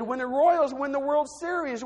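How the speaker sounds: usual speaking voice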